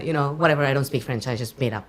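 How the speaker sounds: ordinary talk, nothing marked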